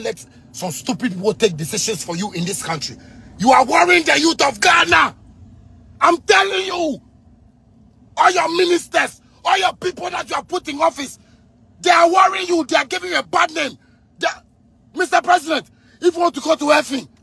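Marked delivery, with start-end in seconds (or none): 3.4-13.8 high, raised shouting plea. Real, not acted. Warning the president about his ministers, a high shouted plea. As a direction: yell it straight at the leader.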